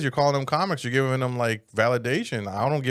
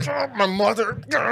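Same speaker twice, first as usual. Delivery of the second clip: high, loud hammed-up cry; mock wail; 12 semitones higher, 4 dB louder than usual. Mocking the guy after a threat, hammed-up wail. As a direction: wail it like a wounded fool.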